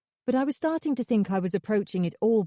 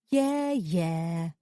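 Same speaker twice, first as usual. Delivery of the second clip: dismissive sing-song, lightly voiced. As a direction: brush it off in a lilting tone. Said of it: "she said in a sing-song voice".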